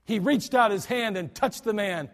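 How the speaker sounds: ordinary talk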